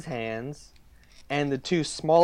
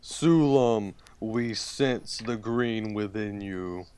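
lightly voiced ancient tree; talking tree; 4 semitones lower, slower than usual. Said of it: "It goes".

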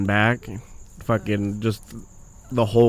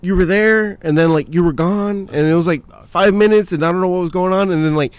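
higher, eager rambling; excited Peter Parker; 7 semitones higher than usual. Retold or imitated. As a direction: spill it out in an amazed rush. Acts Peter Parker's breathless reunion ramble.

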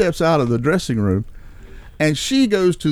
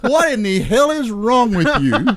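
higher, stretched-out mock outrage; retold indignation; 5 semitones higher, slower than usual. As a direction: indignantly take him to task, retelling it. Retold confrontation with the rude husband.

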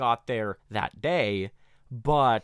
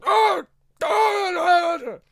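much higher, loud startled scream; waking scream; 8 dB louder than usual. Retold or imitated. Villain waking to blown papers, screaming. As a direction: cry out as if jolted awake. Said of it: "he is screaming the f".

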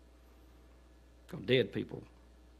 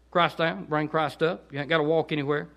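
ordinary delivery

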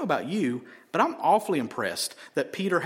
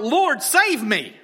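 loud, drawn-out plea raised high; crying out for help; 8 semitones higher, 7 dB louder, much slower than usual. Retold or imitated. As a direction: cry out to the Lord in a preacher's retelling. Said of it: "he cried out".

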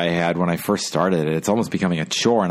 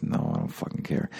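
unvoiced mutter under his breath; fed-up whispered brush-off, barely audible; muttered aside; 14 semitones lower, 9 dB quieter than usual. Real, not acted. Muttered aside while reading headlines.

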